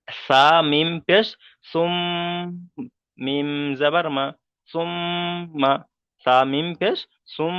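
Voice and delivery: slow spelling, long hummed m in সুম; ghunnah demo. Shown as a demonstration. Teacher showing ghunnah on mim, সুম ending in a drawn-out hum. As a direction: close the lips and hold ম in সুম and সুম্মা. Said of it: "সুম বলে একালি পরিমাণ গণনা করব".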